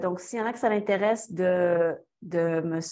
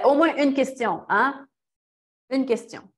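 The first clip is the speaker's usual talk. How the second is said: upbeat, higher, louder plea for one question; coaxing the audience; 6 semitones higher, 4 dB louder than usual. Real, not acted. Speaker coaxing a silent audience for one question, playful. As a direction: cajole the room into asking something.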